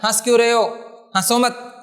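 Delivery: हंस and हंसो with a short nasal vowel, no separate n; quick, short vowel. Shown as a demonstration. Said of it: "ऐसे".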